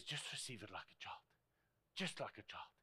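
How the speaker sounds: near-whispered, low urging; gentle hushed instruction. Retold or imitated. Preacher voices Jesus speaking to us, gently hushed. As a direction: drop right down to a soft, low coaxing.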